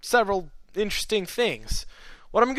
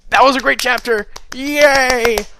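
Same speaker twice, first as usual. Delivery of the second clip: excited; 6 semitones higher, 9 dB louder than usual. Real, not acted. Cheering after finishing chapter.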